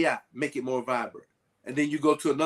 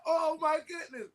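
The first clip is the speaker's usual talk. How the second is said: amazed gasp; 13 semitones higher, 3 dB quieter than usual. Retold or imitated. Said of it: "you're like".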